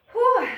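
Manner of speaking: loud high whew; relieved whew. Real, not acted. Exhale after hard set.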